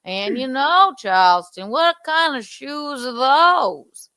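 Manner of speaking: lazily stretched Charleston drawl; slow Charleston accent. Shown as a demonstration. Demonstrating how Charleston folk talk, slow Charleston accent. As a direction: draw the words out like Charleston. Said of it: "that kind of slow sort of Charleston accent".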